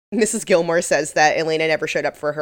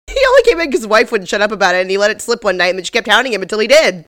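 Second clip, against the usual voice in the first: rattled off at high speed, louder; like five shots of espresso; fast excited retelling; 4 semitones higher, 6 dB louder, much faster than usual. Retold or imitated. Co-host retells Olivia's rushed outburst, fast. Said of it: "talking really fast all of a sudden".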